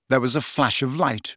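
ordinary delivery

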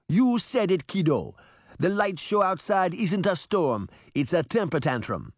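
easygoing Caribbean lilt; Caribbean accent. Acted. Reggie joking to Daphne in a Caribbean accent. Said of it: "his thick Caribbean accent".

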